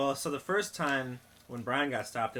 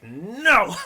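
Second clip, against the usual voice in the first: loud, high protective yell; Mackenzie; 8 semitones higher, 13 dB louder than usual. Retold or imitated. Friend warding off bracelet seller, imitated yell. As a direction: shout it to scare someone off. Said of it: "Mackenzie was like".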